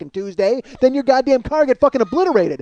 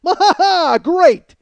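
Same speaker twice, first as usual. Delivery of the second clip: sarcastic laugh; 7 semitones higher, 4 dB louder, slower than usual. Acted.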